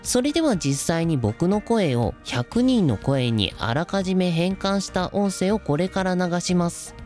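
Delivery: ordinary talk, nothing marked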